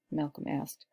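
plain reading voice

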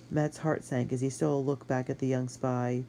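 plain reading voice

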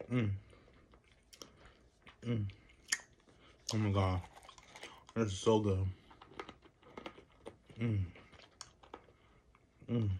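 talking while chewing nuts. Real, not acted. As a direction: mouth partly full of nut. Seen 0: Ate a cashew piece just before; jaw working, lips closed while looking down.

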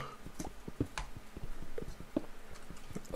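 long open-mouthed yawn; big yawn. Real, not acted. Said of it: "Why am I yawning?".

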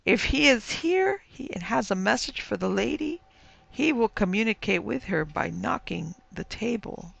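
high, eerie spirit guide; shrill voice from the beyond. A narrator's reading. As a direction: announce it in a piping ghostly voice. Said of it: "said the high voice".

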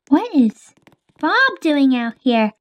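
ordinary talk

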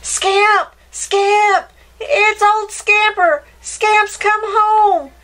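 high, loud happy calling out; a boy calling out to his returning dog; joyful shout. Acted.

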